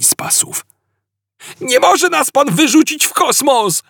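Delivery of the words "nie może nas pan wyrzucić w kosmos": high desperate yell; Ford yelling. A narrator's reading. Ford at the captain, high panicked yell. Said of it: "darł się Ford".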